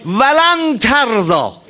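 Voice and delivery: very slow chanted opening; slowly intoned verse opening; Quran recitation. Acted. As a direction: draw the words out like a reciter.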